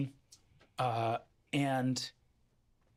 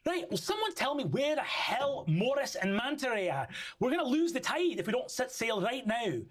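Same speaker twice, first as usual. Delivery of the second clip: higher, impatient barking at the crew; shouting angrily; 11 semitones higher than usual. Acted. Captain Annabelle angrily shouts orders across the deck. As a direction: yell for the crew to hurry. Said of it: "shouting angrily".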